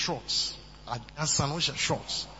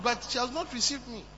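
usual speaking voice

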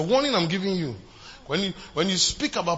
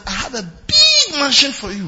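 a touch of tearful, loud promise; voice of Jesus; 9 semitones higher, 7 dB louder than usual. Acted. Preacher voicing Jesus promising a mansion, tearful. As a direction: give Jesus' promise with emotion.

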